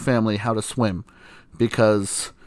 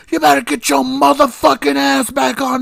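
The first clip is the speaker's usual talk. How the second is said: much higher, louder mock scream from the hill; imitating mom's scream; 13 semitones higher, 5 dB louder than usual. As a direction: play the furious mother yelling across the lake. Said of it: "and she's screaming".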